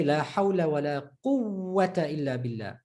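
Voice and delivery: quwwata held long on the ww within the phrase; shadda on waw. Shown as a demonstration. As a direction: recite it, stretching the ww of quwwata. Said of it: "Shadda on the waw".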